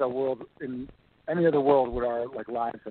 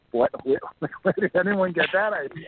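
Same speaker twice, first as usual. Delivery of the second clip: higher, laughing incredulity; mock disbelief; 7 semitones higher than usual. Actor recalling being cast as an Italian mobster, amused disbelief. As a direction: act out baffled amusement at the idea. Acts out reaction to odd casting.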